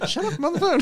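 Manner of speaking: high squeaky laughing snap; mock shout through laughter. Telling a friend to hush mid-call, laughing. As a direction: blurt it out while cracking up, very high. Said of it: "I'm like".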